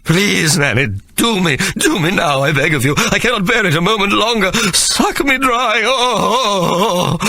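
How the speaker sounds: very high, breathless pleading; desperate begging. A narrator's reading. Sir Jasper begging Nanny, very high desperate pleading. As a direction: beg with rising desperation.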